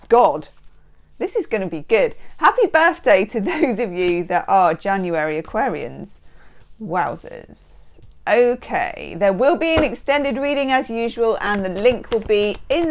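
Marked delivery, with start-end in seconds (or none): none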